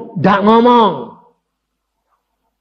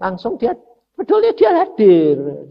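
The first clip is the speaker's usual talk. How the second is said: normal talking voice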